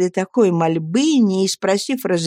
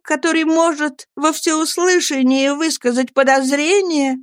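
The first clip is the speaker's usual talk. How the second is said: put-on a higher, earnest young wife's voice; Annie pleading; 8 semitones higher than usual. Young wife begging her friends to speak, pleading.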